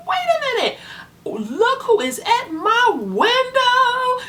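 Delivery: imitating the mom's high, excited squeal; excited high mimic. Imitating the birth mom at the drive-through window, high and excited. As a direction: squeal it out like the birth mom spotting an old friend. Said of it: "And she was like".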